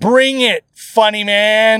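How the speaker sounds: loud, slow mock challenge; mock taunt. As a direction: dare him, drawn out and loud.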